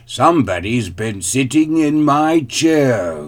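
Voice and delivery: loud, great big bear voice; growling father bear. A narrator's reading. Father bear finds his chair used, growling. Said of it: "growled the father bear in his great big voice".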